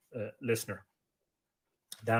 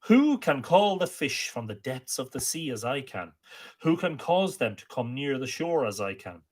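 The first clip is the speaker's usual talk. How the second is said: poem recitation; 6 semitones higher, 5 dB louder than usual. Acted. Amergin landing on Ireland proclaims his song, declaimed. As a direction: proclaim each line like an ancient bard.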